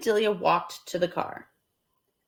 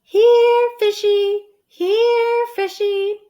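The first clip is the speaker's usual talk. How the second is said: high, loud singsong call; calling the fish; 13 semitones higher, 7 dB louder, much slower than usual. Acted. Amelia Bedelia calling to a fish, high and loud. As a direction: coax the fish loudly. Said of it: "she called".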